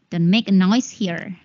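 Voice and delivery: usual speaking voice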